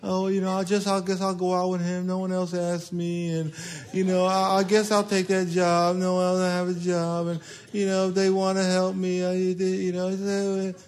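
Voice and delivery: soft, hesitant mumble; a hint of a timid pushover; timid whiny voice. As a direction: spineless little mumble. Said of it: "this little spirit of".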